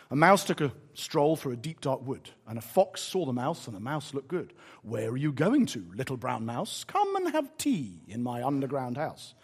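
low, soft storybook reading; story narration. A narrator's reading. Preacher reading The Gruffalo to the congregation, soft storytelling. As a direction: read it gently like a bedtime tale.

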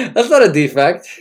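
loud, mock-earnest proclamation; emphatic declaration. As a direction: declare it loudly like a sermon.

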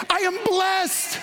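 high-pitched, slow, emphatic declaration; mean it. Shown as a demonstration. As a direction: declare it with total conviction. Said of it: "No, no, mean it".

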